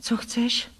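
small hesitant question; understated nervous question; timid, embarrassed. A narrator's reading. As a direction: ask timidly, a touch afraid. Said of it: "zeptala jsem se rozpačitě a bojácně".

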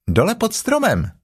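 put-on high, childlike kangaroo voice; high-pitched youngster; little Roo. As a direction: pipe up like a little one. Little Roo answers Tigger.

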